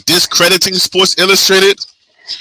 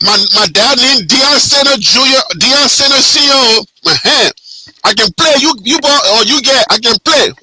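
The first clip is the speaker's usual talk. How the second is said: Liberian English, pitched up; Liberian accent; 4 semitones higher than usual. Pretend. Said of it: "we gotta put it in our Liberian accent".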